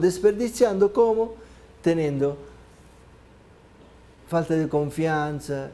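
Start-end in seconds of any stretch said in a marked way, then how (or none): none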